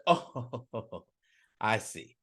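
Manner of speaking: gloating devil. Acted. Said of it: "And the devil said".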